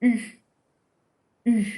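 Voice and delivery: "U" said as French u, tongue flat, lips in a tight circle; French u sound. Shown as a demonstration. Teacher modelling French "U" for students, lips rounded. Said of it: "close your lips a little bit and make a circle".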